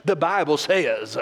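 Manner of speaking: slow Southern stretch on says; Southern preacher accent. Shown as a demonstration. Telling your flesh no, in a slow Southern accent.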